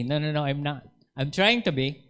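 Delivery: ordinary talk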